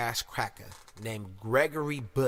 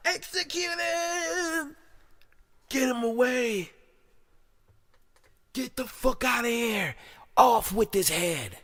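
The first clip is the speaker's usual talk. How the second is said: high-pitched, drawn-out cries; theatrical royal command; 10 semitones higher, slower than usual. Acted. Film ruler condemning a killer, high theatrical cries. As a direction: wail the orders like a hammed-up ruler.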